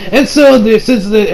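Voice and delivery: ordinary talk, nothing marked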